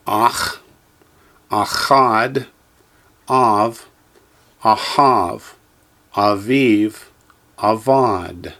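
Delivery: each word said slowly and clearly, with a pause after it; teacher's careful drill voice; Hebrew words for echoing. Shown as a demonstration. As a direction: model every Hebrew word for the class to repeat. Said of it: "echo me, please".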